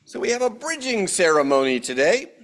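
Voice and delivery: ordinary delivery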